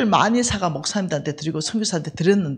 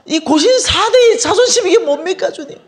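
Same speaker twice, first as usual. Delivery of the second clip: much higher, louder, fired-up protest; indignant outcry; 10 semitones higher, 8 dB louder than usual. Retold or imitated. Demanding an answer from God about pride, indignant. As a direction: demand an answer from the Lord, fuming. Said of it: "열받아가지고".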